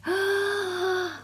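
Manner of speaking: sharp in-breath of wonder; astonished storyteller gasp; awed gasp. A narrator's reading. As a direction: gasp at the wings. Wings revealed.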